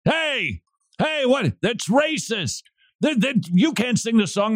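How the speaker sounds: high, mocking whiny protest; mock outraged liberal. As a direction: lampoon a shocked objector.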